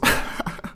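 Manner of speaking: short breathy imitation of his laugh; imitated laugh. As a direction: mimic a boy's brief chuckle. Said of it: "er macht so".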